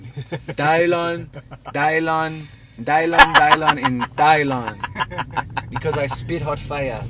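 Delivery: hammed-up impression of a cocky rapper; mock rapper boast. Rapper naming himself as the five best, hammed-up boast. Mimics comedy bit, names himself five times.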